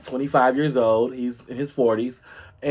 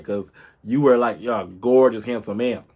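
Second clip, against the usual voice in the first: usual speaking voice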